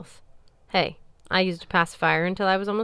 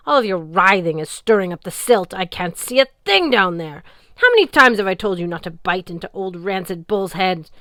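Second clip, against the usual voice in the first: loud, irritated goddess voice; scolding goddess; 8 dB louder than usual. Acted. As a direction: scold a disobedient pet.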